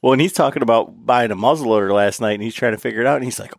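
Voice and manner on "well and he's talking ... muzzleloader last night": normal talking voice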